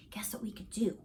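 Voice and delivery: soft, unvoiced teasing whisper; secretive whisper. Friend hints at ghost stories.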